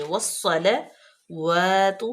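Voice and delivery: "والصلوات" very slow, the لام light; careful recitation. Shown as a demonstration. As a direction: model "والصلوات" slowly. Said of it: "لام کو باریک پڑھیں گے".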